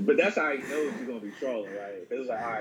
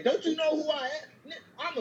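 high-pitched offended outburst, don't you know who I am; faster than usual. Retold or imitated. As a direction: play an insulted star losing it. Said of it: "Be like".

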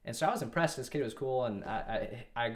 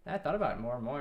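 ordinary talk

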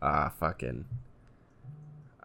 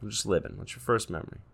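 softer, gentle probing therapist; 3 semitones lower, 11 dB quieter, faster than usual. Acted. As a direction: coax the memory out quietly. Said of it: "That was my impression of a therapist".

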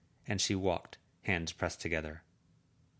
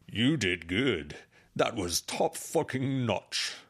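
slow, strange creature drawl praising her; 4 semitones higher, 3 dB louder, much slower than usual. Acted. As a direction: savour each word like a lurking creature. Toad Swallow praising, creature voice.

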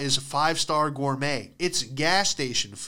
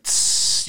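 cigarette sizzle; 3 dB louder than usual. Retold or imitated. Cigarette put out on neck.

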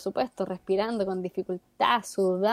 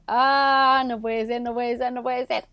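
disbelief; 3 semitones higher, 4 dB louder than usual. Retold or imitated. Voicing Cristina's disbelief.

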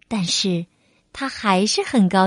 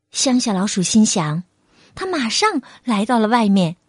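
ordinary delivery

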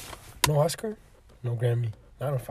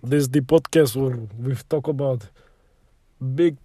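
put-on Russian accent. Host welcoming listeners back to the podcast, mock Russian accent. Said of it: "I can do Russian a little bit".

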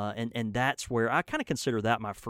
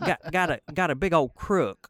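put-on Mississippi accent; 5 semitones higher, 6 dB louder than usual. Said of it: "I'm gonna do my real thick Mississippi accent".

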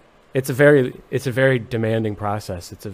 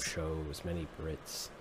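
very soft, lower mumble; mumbled; 7 semitones lower, 21 dB quieter, much slower than usual. Acted. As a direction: trail away so it can barely be made out. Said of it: "You said many what?".

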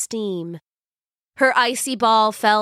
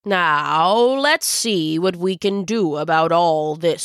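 put-on kind old wizard; 3 semitones lower than usual. Wizard kindly praises Silva.

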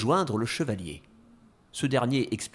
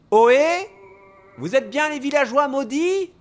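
loud, high hail to distant figures; high-pitched call, pushed loud; 14 semitones higher, 7 dB louder than usual. Acted. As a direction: shout the question across to them. Hero hails the ghosts.

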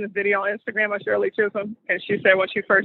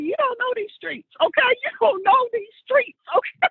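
much higher, sassy imitation with a laugh in it; Shirley Chisholm voice; 13 semitones higher than usual. Cohost imitating Chisholm facing down the Texas men, high and sassy. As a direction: play her defiant, teasing the Texas men. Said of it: "And she was like".

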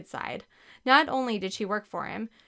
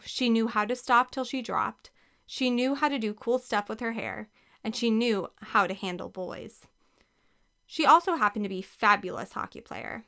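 usual speaking voice

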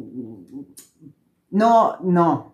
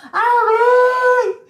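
much higher desperate call; retold shout; 4 dB louder than usual. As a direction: scream the order. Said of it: "Y le digo".

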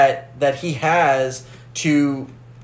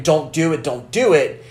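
normal talking voice

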